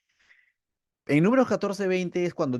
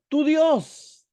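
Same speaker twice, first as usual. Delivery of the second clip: high, loud accusing outburst; complaining; 12 semitones higher, 6 dB louder than usual. Retold or imitated. Someone complaining and disowning God, loud reproach. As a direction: throw it at them as a reproach. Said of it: "alguien quería reclamar algo y decía".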